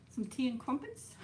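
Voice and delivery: badly faked British, lifting into a question; attempted British accent. Shown as a demonstration. Streamer retrying the accent for viewers, half-hearted British accent. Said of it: "Try a British accent".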